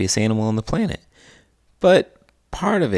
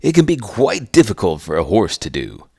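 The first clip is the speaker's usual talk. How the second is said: quokka voice; 6 dB louder than usual. Acted. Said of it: "The quokka says".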